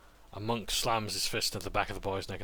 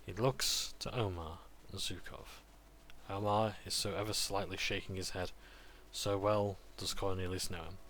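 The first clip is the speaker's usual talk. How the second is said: ordinary delivery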